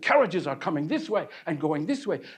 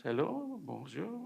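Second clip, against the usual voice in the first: flirty greeting; 13 dB quieter than usual. Acted.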